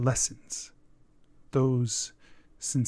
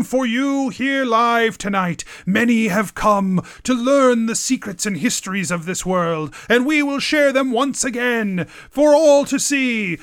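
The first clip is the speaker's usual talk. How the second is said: barker's shout; 6 semitones higher than usual. Acted. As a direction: bellow it like a festival barker drawing people to the stage. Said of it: "shouting out".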